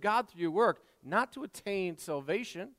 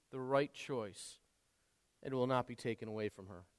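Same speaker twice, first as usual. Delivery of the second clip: gentle. Acted. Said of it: "he doesn't scream at her".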